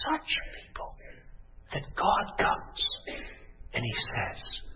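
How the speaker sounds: breath-soft, almost whispered preaching; hushed awe. Acted. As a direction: drop to a near whisper in wonder. Hushed lead-in to God's offer.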